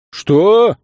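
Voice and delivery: loud, high outraged yelp; sharp rising cry of outrage; indignant. A narrator's reading. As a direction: burst out in disbelief. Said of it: "возмутился тот".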